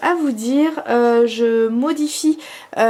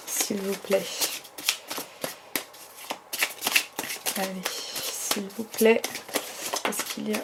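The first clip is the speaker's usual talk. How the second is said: soft pleading; 4 semitones lower, 12 dB quieter than usual. Real, not acted. Softly begging the cards to answer.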